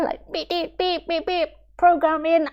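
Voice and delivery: high, light machine beeping; robot beeps. Retold or imitated. Teacher mocking a programmed mind with high robotic beeps. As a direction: beep it out like a computer.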